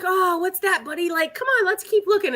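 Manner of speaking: high coaxing pet voice; talking to the dog. Retold or imitated. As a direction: coax the dog along brightly. Talking to her dog at trial.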